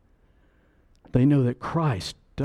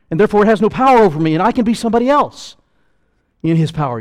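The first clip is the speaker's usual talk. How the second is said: loud, high preaching voice; 6 semitones higher, 7 dB louder, faster than usual. Acted. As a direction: preach it out with full force. Preaching at full force.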